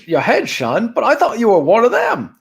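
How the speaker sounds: movie quote voice. Retold or imitated. Quoting Last Crusade dialogue.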